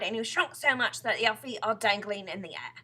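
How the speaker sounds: loud, mocking exclamation; crying out. A narrator's reading. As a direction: keep hounding her loudly. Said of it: "he cried".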